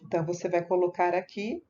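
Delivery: usual speaking voice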